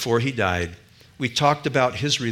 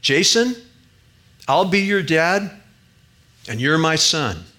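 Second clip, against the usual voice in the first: louder and higher, calling Jason by name; loud embrace; 5 semitones higher, 5 dB louder than usual. Retold or imitated. Older man Phil loudly telling Jason he'll be his dad. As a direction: proclaim it loudly, slow on I'll be your dad. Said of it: "in a loud voice said to him".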